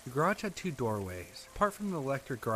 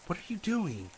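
higher-pitched, restrained cry of alarm; startled outcry, pitched up; mild narrated scream; 7 semitones higher than usual. A narrator's reading. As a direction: voice a scream without fully screaming. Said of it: "the scream".

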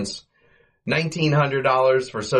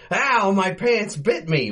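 higher, slow startled whine; mock yelp; 11 semitones higher, slower than usual. [name] yelping after grabbing the moving pants, higher slow whine. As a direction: squeal it like the pants really bit.

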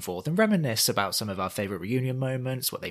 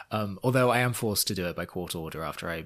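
ordinary delivery

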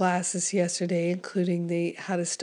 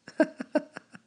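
brief high giggle; short laugh; 9 semitones higher than usual. Real, not acted. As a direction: let out a quick laugh. Laughs at her voice.

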